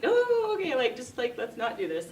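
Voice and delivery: put-on high soothing coax to a crying baby; coaxing a toddler. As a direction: gently talk a fussy little girl down. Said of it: "I'm like".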